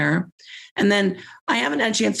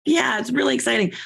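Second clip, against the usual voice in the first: ordinary talk, nothing marked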